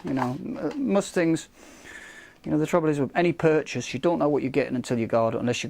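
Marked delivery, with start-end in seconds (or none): none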